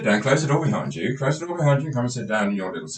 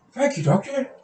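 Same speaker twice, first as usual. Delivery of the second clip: much higher, put-on voice of a timid patient. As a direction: play the nervous patient, pitched well up. Patient thanks doctor.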